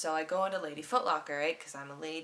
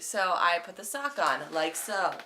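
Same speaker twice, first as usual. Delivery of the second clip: usual speaking voice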